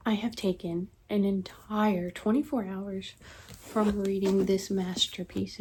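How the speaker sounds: ordinary delivery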